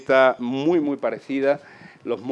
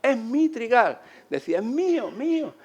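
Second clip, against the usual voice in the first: put-on high, emphatic old man; insistent old man; 10 semitones higher than usual. Old farmer insisting the wheat field is his. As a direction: insist it's yours.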